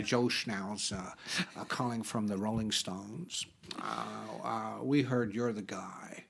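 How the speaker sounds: prank caller voice. Retold or imitated. Prankster posing as Stones rep, low put-on voice. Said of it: "some made-up name like".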